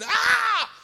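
held, hammed-up shriek; mock scream. Preacher imitating injured lady on the ground, screaming. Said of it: "She's screaming her head off".

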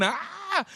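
high, held mock scream, kept soft; imitated scream. Preacher imitating the injured woman on the ground, mock scream. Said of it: "she's on the ground screaming".

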